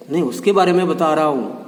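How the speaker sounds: ordinary talk, nothing marked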